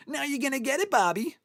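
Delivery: hammed-up Happy Gilmore taunt; imitating Happy Gilmore. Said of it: "find that short of him going".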